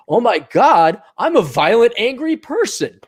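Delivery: hammed-up naive panic; mock horror. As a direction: exclaim in exaggerated dismay. Acts naive man's horrified self-blame.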